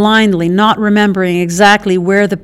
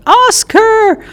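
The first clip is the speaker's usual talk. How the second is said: a furious high scream of the name; betrayed partner screaming at the getaway driver; screamed in fury; 14 semitones higher than usual. Acted. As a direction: yell it after the fleeing car. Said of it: "Blue screamed in fury".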